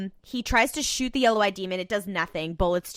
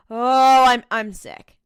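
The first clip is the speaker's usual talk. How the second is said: loud, higher groan of revulsion; mock disgust; 5 semitones higher, 11 dB louder, slower than usual. Viewer reacting to a creepy romance scene, mock-disgusted. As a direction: groan it out loudly, then settle.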